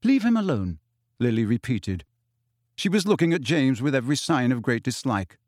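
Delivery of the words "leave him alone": higher, firm order to back off; mildly indignant girl telling him to stop; firm demand. A narrator's reading. Lily confronts James, repeated demand.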